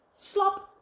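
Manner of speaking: high, punchy splat word; bright sound-effect slop. A narrator's reading. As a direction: pop it out like a splash.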